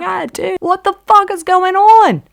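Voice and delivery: high, loud outburst of disbelief; frantic shout. Retold or imitated. Confronting the partner who walks over, frantic shouting. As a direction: yell at them, demanding an explanation.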